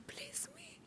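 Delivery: whispered plea; tiny pleading whisper. Retold or imitated. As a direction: breathe it, begging softly. Begging to cover class.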